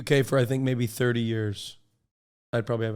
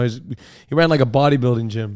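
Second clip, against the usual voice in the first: usual speaking voice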